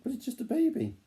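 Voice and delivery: high, playful protest; mock disbelief. Real, not acted. Parent playfully doubting the child's plan, high mock disbelief.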